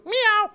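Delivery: high-pitched mock cat meow; squeaky pretend cat; imitated meow. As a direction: answer with a high little meow. Imitates wife answering with meow.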